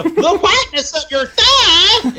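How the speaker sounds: put-on high, slow, astonished hillbilly accent; shocked hillbilly accent. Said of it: "would say it in shock and awe".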